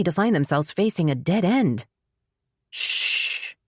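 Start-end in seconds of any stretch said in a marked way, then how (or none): 2.7-3.5 breathy hushing whisper. Acted. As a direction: hush her in a whisper. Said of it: "he whispered".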